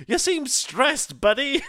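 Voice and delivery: slow, high, loud mock-cheery gadget; chirpy phone alert. Phone butting in mid-presentation, high and loud mock cheer. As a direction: imitate an intrusive smartwatch, pitched right up. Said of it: "my phone started vibrating and went".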